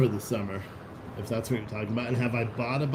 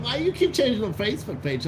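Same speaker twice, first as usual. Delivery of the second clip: mocking whiny viewer; 11 semitones higher than usual. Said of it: "They're like".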